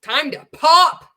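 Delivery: loud, high, drawn-out cheer; hammed-up promo shout; mock hype slogan. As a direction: belt it out like a cheesy slogan.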